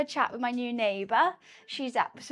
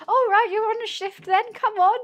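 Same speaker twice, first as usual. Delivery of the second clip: mock bar staff; 9 semitones higher, 7 dB louder than usual. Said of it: "they were like".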